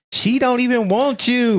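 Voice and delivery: high-pitched, acted-out plea; put-on lovelorn pleading; mock pleading. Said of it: "she's pleading to him".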